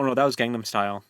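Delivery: usual speaking voice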